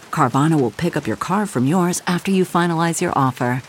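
ordinary talk

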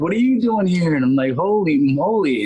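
high, swooping excited disbelief; retold squeal of delighted surprise; amazed reunion. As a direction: draw it out, astonished to see your old friend.

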